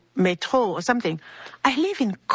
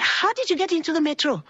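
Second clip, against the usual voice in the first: retold exasperation, high and quick; upset, incredulous; 9 semitones higher, faster than usual. Upset friend questioning the ticketless traveler, retold exasperation. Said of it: "She was really upset".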